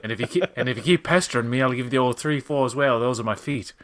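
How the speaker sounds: put-on old-timey Mid-Atlantic talk; lightly voiced lockjaw old-timer; Mid-Atlantic accent. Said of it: "Mid-Atlantic is more like this".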